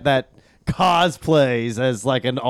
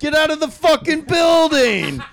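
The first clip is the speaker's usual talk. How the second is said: mocking yell; 12 semitones higher than usual. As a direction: yell at them to get out. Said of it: "It's like".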